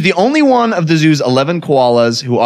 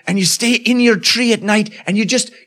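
pleading koala elder; 6 semitones higher than usual. Acted.

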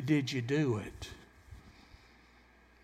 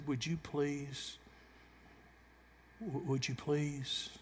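slow, much softer begging; pleading; 8 dB quieter, slower than usual. Retold or imitated. Someone with pleading eyes asking, slow soft plea. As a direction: beg gently, drawn out. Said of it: "looked at you in pleading eyes and said".

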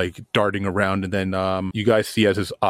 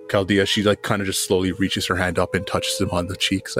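ordinary talk, nothing marked

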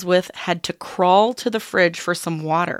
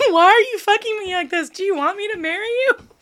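laughing; 13 semitones higher, 6 dB louder than usual. Real, not acted. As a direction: quote squeezed out through laughter. Okay, that's funny.

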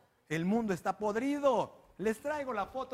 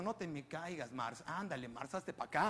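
low, much softer coaxing murmur; creepy reverend; 6 semitones lower, 8 dB quieter than usual. Retold or imitated. Voicing the reverend holding [name], low and hushed. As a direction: coax her close, low and soft. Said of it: "como diciendo".

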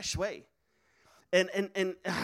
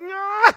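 loud, high mock effort grunt; straining to work it up; 11 semitones higher, 12 dB louder than usual. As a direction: strain noisily as if forcing it out. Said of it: "just kinda work it up".

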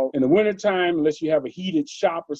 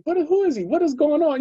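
puzzled bystanders; 12 semitones higher than usual. Retold or imitated. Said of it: "everybody started looking like".

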